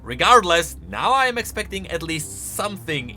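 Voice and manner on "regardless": ordinary talk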